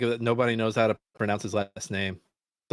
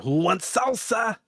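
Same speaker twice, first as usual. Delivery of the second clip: slow, higher silly character voice; slow goofy Salsa Man voice; 8 semitones higher, slower than usual. Pretend. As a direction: ham up a goofy salsa mascot. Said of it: "he's called Salsa Man".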